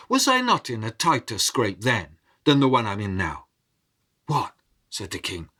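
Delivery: measured storyteller voice, dramatic rises and hushes; story narration. A narrator's reading. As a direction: tell the tale to a listening crowd. Storyteller narrating a folk tale.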